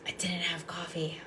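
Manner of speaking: soft unvoiced whisper; hushed confession. Real, not acted. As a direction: whisper it like a guilty secret. Confessing no coffee, aside.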